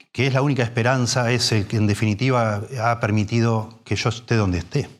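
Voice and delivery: ordinary talk, nothing marked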